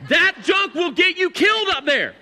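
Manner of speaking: high, loud exasperated outburst; overprotective dad. Retold or imitated. Dad warning his goofing family on the trail, exasperated. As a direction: let it climb into a worked-up rant.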